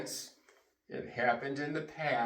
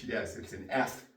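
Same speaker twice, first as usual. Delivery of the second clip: ordinary delivery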